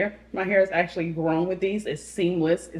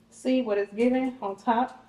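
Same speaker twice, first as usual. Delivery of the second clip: ordinary delivery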